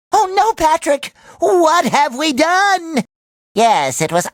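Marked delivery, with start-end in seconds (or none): 0.1-3.0 high, drawn-out dismay; horrified. Acted. To Patrick over the damage they caused, horrified. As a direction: wail it in slow horror.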